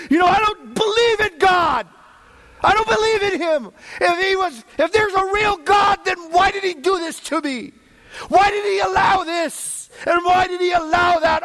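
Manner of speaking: high, loud shouting of a bitter unbeliever; angry doubter. Acted. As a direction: shout it like a man blaming God. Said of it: "It's the kind of guy who says".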